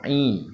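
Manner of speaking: "I" voiced through the nose, falling; single nasal Arabic 'ain on "I". Shown as a demonstration. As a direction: push "I" into the nose. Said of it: "suara di hidung itu".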